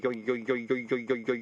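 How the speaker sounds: mimicked wobbling plate yoing; spinning plate sound. A narrator's reading. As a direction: imitate a plate spinning to rest. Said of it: "yoing sound".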